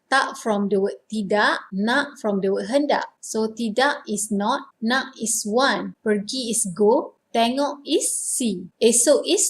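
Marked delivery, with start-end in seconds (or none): none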